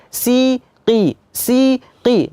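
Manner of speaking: siqi with a very thin, light i. Shown as a demonstration. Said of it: "harus benar-benar tipis".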